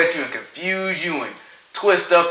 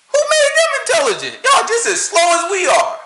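pitched way up, mock outrage; high indignant outburst. Real, not acted. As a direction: throw the question up high. Indignant rant, pitch jumps.

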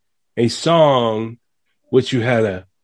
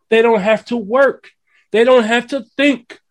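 passionate rant; 10 semitones higher than usual. Real, not acted. Ranting about modern artists.

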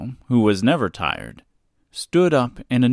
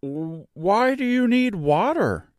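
slow, higher scarecrow question; a curious scarecrow, drawn out; creaky scarecrow; 11 semitones higher, slower than usual. Acted. Scarecrow asks Dorothy.

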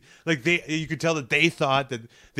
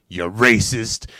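slow deadpan accusation; 3 semitones lower, 7 dB louder, much slower than usual. Retold or imitated. Deadpan punchline at leader.

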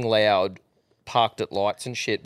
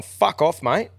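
higher, retold dismissal; mock telling-off; 6 semitones higher, 4 dB louder, slower than usual. Lads sending off the dodgy driver, mock angry. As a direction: imitate warning someone off, pitched up.

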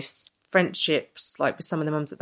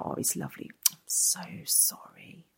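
mock pitying stranger; 10 dB quieter than usual. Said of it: "and they go".

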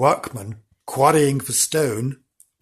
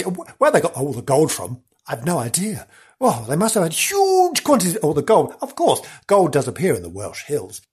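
ordinary delivery

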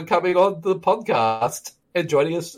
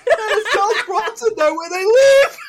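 laughing shout. Real, not acted. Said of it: "[name], are you okay?".

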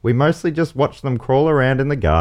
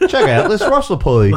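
higher, laughing fake Aussie accent; an American faking an Australian accent; American doing Australian; 10 semitones higher, 3 dB louder than usual. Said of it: "an American doing an Australian accent".

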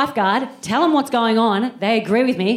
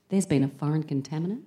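much softer, low conspiratorial tone; secretive lean-in whisper; 7 semitones lower, 9 dB quieter than usual. Retold or imitated. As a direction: confide it like a grave secret. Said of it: "she leans in and she goes".

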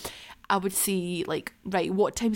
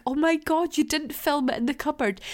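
higher-pitched mock amazement; amazed listeners; 6 semitones higher than usual. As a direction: imitate astonished fans, pitched up. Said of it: "messages I got from you guys being like".

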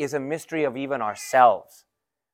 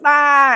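imitating a toddler's high, loud yelp; mock toddler wail, high and loud; child's cry; 8 semitones higher, 6 dB louder than usual. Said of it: "And he goes".

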